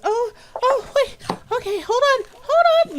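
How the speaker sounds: high, hurried, flustered voice; flustered halfling woman. Acted. Flustered halfling calling through the door to visitors. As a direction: caught off guard, scrambling to the door.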